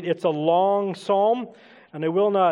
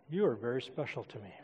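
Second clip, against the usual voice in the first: very soft, low and quick; withdrawn delivery; 8 semitones lower, 14 dB quieter, faster than usual. Shown as a demonstration. Preacher showing awkward delivery to church, withdrawn and very soft. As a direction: drop it low, barely offered. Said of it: "Say it avoiding eye contact".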